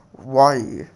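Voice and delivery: lightly voiced beast, questioning; a hint of monster curiosity; intrigued Ninetales. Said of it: "he was kind of intrigued".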